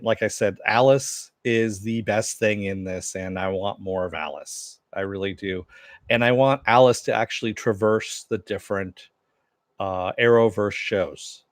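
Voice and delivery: usual speaking voice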